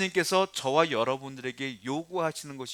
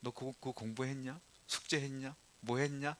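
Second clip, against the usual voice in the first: put-on mild, soft nagging of a child; nagging parent; 3 semitones lower, 12 dB quieter than usual. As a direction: imitate a parent checking up, much softer. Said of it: "그러니까 잔소리가 많아".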